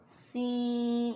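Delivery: سین with the ee held very long; long madd on seen. Shown as a demonstration. Teacher counting fingers while stretching سین. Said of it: "مدہ آ رہا ہے".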